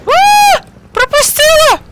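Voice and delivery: startled scream. Real, not acted. Startled scream during gameplay.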